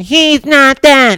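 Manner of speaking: high, slow, mocking whine; mocking quote. Mocking critics who defend the reporter, in a high drawn-out whine.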